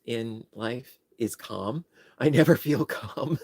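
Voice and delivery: ordinary delivery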